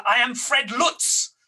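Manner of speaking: slow, weighty self-introduction; grand announcement. Retold or imitated. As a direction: let the name land heavily. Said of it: "He said".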